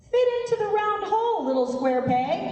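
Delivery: lightly put-on voice pushing someone to conform; high-pitched, hurried mock coaxing; mock societal nudge.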